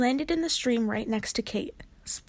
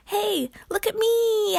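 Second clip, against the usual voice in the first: high, slow, louder whoop of triumph; excited shout; 10 semitones higher, 5 dB louder, slower than usual. Acted. Kate launching into the air, shouting proudly. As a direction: show off as you shoot up.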